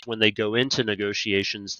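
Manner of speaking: ordinary delivery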